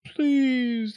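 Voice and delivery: high, drawn-out plea; wailing. A narrator's reading. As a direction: wail it like a suffering fox. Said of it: "the fox wailed".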